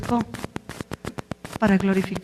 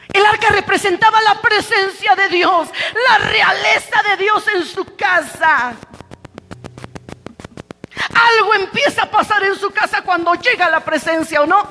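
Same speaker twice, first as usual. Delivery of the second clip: full-force preaching; 10 semitones higher, 4 dB louder than usual. Real, not acted. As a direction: build each list to a ringing pulpit cry. (predicación a plena voz, con fervor)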